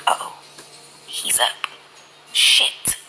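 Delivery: unvoiced, scared-kid whisper; caught-out whisper. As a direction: whisper like you just heard a parent coming. Caught awake hearing him walk.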